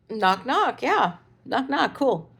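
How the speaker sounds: mock-cheerful high knock knock; high sarcastic echo. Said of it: "And I'm like".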